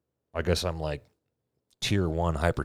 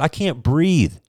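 higher, louder pleading insistence; exasperated plea; 10 semitones higher, 8 dB louder, slower than usual. Retold or imitated. Patient pleading with the doctor to hear him, exasperated. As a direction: make her finally listen.

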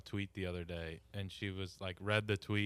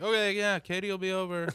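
high, louder mock-cheery brush-off; mock cheery reply; 10 semitones higher, 7 dB louder than usual. To his mom, dodging the phone lesson with a high mock-cheery yes. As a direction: chirp it up high to dodge her.

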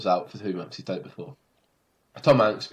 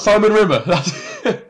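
sudden high loud burst of recognition, then a laugh; excited recall; 11 semitones higher, 9 dB louder, slower than usual. Real, not acted. Remembering the chef's name for her friend, excited. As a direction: blurt the name out the moment it comes back.

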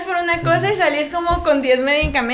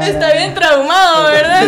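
louder, slow, laughing tease; 5 semitones higher, 11 dB louder than usual. Real, not acted. Teasing the friend about the doctor, laughing.

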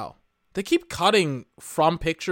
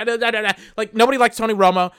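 usual speaking voice

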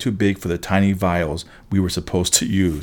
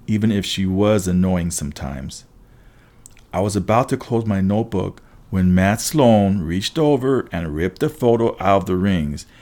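usual speaking voice

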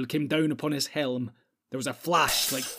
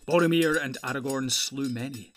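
usual speaking voice

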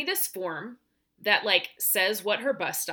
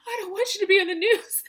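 put-on higher, quicker little-girl voice with a laugh; daughter's voice; 11 semitones higher, 5 dB louder, faster than usual. Mother imitating her daughter's worried reply, high and laughing. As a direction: mimic the kid, laughing through it. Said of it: "And my daughter's like".

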